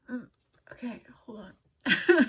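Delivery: a whispered hold on breaking into a loud sob-laugh; fighting back real tears; tearful whisper then burst. Real, not acted. To her viewers, halting the reading, whispered then sobbing. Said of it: "I'm gonna try to read without crying".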